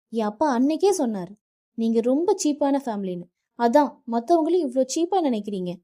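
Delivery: wife hurling insults at her husband's family; angry outburst. Acted. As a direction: lash out with words you regret. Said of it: "கோபத்தில் நித்யா வார்த்தைகளை விட".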